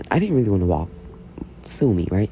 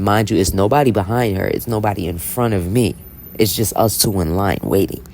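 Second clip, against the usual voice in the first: ordinary talk, nothing marked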